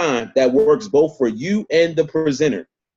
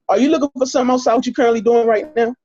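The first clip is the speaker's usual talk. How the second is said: quick, raised "reading it" delivery; read-out sounding; 6 semitones higher, faster than usual. Shown as a demonstration. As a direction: sound like you're reading from a script. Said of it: "Don't sound like you're reading it".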